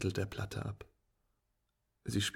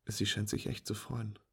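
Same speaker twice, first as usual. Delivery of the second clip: ordinary delivery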